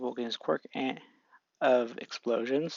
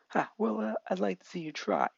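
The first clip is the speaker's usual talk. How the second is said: cocky taunt; 7 semitones higher than usual. Acted. Bakugo dares Deku to fight, light smug high voice. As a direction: dare him to try.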